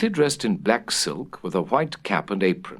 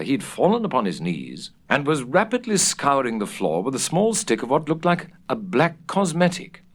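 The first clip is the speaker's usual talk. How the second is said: ordinary delivery, nothing marked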